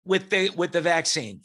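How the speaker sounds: ordinary talk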